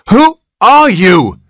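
high, loud, slow frantic outburst. Acted. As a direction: burst out in panic. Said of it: "burst out Mister Button frantically".

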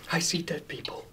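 hushed, eerie movie whisper; whispered film quote. Acted. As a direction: whisper it like a secret confession. Quoting a famous film line.

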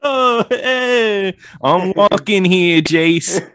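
loud high New York tough guy; brash New York street voice, slowed; New York accent. Acted. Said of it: "New York accent".

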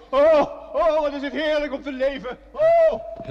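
high, loud cries of joy, ending in a very high oh; ecstatic joy. Acted. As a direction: burst out with the joy of being alive. Rescued man rejoices at life. (uitbundige vreugde, hoog en luid)

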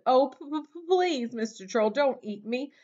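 high, pleading, stammering at the start; high-pitched anxious storybook plea; wobbly, frightened goat. A narrator's reading. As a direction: beg the troll nervously. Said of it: "Middle Billy Goat Gruff wobbled".

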